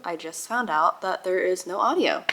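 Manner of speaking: ordinary talk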